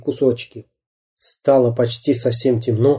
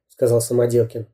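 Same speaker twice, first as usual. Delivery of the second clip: ordinary delivery, nothing marked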